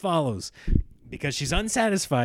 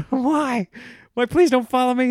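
exasperated plea; 9 semitones higher, 7 dB louder than usual. Retold or imitated. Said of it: "I was like".

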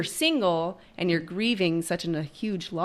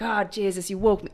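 a hint of an Irish husband grumbling at being woken; mild Irish accent joking about being woken; 3 semitones higher than usual. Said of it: "he's Irish. That's why I'm doing that voice".

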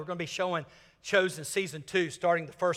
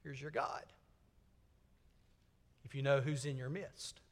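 much softer, low, hushed; whispered; 6 semitones lower, 13 dB quieter than usual. Pretend. Jesus confiding to the forgiven man, hushed whisper. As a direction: lean in and whisper it. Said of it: "and whispers".

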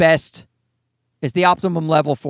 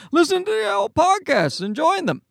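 a light impression of dad, pitched higher; imitating his father; 10 semitones higher than usual. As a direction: do dad's voice, a bit higher. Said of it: "that's how he talks".

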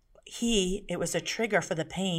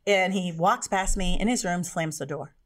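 normal talking voice

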